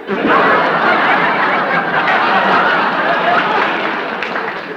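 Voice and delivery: big audience laugh; audience laughter. Real, not acted. Studio audience laughing at the joke.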